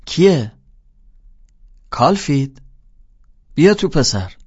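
loud, high call through the open door; old teacher shouting. Acted. Old teacher shouting for the boy to come in. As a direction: holler it out from his armchair. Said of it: "داد زد".

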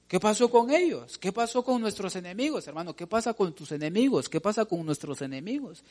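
usual speaking voice

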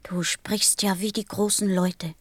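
whispered, disappointed; hushed reproach. Acted. Little prince whispering a reproach at the busy pilot. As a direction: breathe it out like a quiet accusation.

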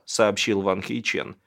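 ordinary delivery, nothing marked

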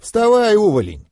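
high, loud command dropping at the end; mentor's snorted command; scornful snort. A narrator's reading. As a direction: order the fallen pupil up with scorn. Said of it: "фыркнул наставник сверху вниз".

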